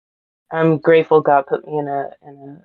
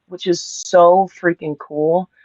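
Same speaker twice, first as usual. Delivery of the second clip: ordinary talk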